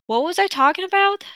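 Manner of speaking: usual speaking voice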